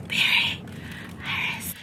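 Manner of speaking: soft, airy, all breath; breathy imitation. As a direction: breathe the words like a hushed hero. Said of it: "both be so damn breathy".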